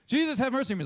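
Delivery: a high, loud cry for mercy; calling out. Retold or imitated. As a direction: call out to Jesus over the crowd. Blind beggar calls out to Jesus.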